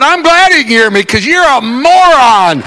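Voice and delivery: high, loud exasperated retort; mock hollering. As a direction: fire back in comic indignation. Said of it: "when I holler at her".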